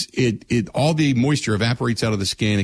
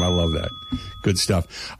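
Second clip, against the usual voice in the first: ordinary talk, nothing marked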